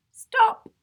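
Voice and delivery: usual speaking voice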